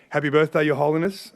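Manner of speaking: joyful birthday salute; festive toast. Real, not acted. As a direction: call it out to the whole room. Toast to the Dalai Lama.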